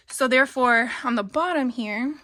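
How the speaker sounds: breathless from moving around; out of breath. Real, not acted. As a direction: speak while catching your breath. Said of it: "I'm sorry, I'm out of breath".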